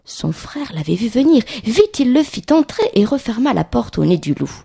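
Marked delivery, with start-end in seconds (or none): none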